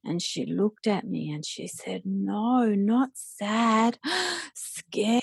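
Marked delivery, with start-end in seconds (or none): 2.1-5.2 put-on light little-girl voice rising high on scared with a gasp; imitating toddler. Daughter correcting her mother, little-girl voice with a gasp. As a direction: play the small daughter, high and gasping at the end.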